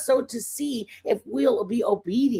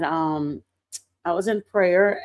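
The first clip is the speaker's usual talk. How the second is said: usual speaking voice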